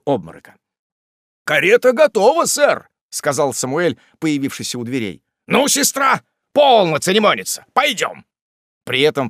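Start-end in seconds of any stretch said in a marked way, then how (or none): 1.4-2.9 high, loud servant's announcement; calling out. A narrator's reading. Samuel reports to the gentleman from the door, calling out. 5.5-8.2 loud, high, brusque urging; impatient command. A narrator's reading. Wardle orders his sister to come, impatient.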